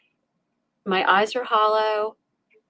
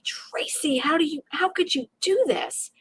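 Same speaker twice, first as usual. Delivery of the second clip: scolding; 7 semitones higher than usual. Retold or imitated. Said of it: "my head is scolding me".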